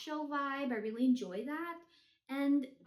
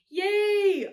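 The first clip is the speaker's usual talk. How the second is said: loud, high yay; cheer; 8 semitones higher, 12 dB louder than usual. Real, not acted. Reviewer cheering as the book goes on the shelf, loud.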